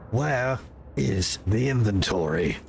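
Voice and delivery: loom over him with cold authority; stern commander voice. Acted. Player character voice.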